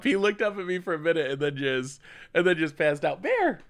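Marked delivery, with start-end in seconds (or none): 3.2-3.6 high call across the room; real high call; calling the dog. Real, not acted. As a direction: call the puppy's name brightly. Calling the puppy.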